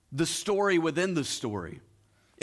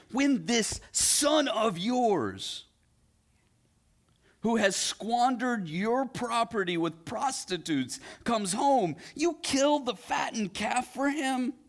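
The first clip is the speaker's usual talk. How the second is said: high, slow, sneering emphasis on this son of yours; older son refusing to call him brother, contemptuous; bitter resentment; 7 semitones higher, slower than usual. Acted. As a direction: spit out each word like an accusation. Resentful older son accuses father.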